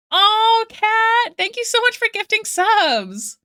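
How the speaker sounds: loud, high-pitched squeal of gratitude; excited thanks. Real, not acted. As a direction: burst out with delighted thanks. Thanking viewer for gifted subs.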